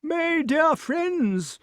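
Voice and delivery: put-on high, slow old king calling out; shouted. A narrator's reading. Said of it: "shouted King Pellinore".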